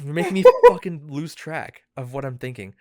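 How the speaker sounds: ordinary talk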